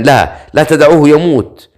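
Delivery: high, restrained narrated cry. A narrator's reading. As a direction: raise the pitch in a mild, urgent shout. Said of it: "صرخ الكاهن". (صرخة خفيفة بنبرة مرتفعة)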